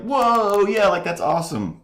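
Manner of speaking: squealing high and loud, gamer-style; hammed-up excited squeal; mock hype. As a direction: overdo the wow like a hyped fan. Mock quoting gamers' hype reaction.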